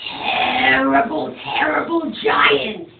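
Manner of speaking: slow, rising then falling on terrible; dramatic stress. A narrator's reading. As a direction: stretch out terrible, terrible with dread. Storyteller builds up the giant.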